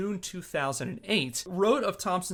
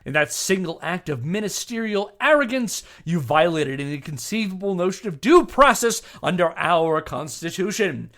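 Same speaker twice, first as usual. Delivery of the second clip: louder, indignant courtroom oratory; Jack Thompson reading his letter; 8 dB louder than usual. Retold or imitated. Host voicing Jack's letter to the referee, indignant and louder. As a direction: read the letter like an outraged lawyer scolding a judge. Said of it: "back to Jack".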